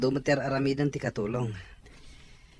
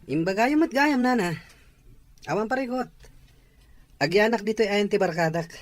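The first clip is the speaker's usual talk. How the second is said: higher and louder, brushing off worry; eager reassurance; 6 semitones higher, 5 dB louder than usual. Acted. Son eagerly reassures his worried mother, higher and louder. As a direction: cheerfully insist nothing is wrong.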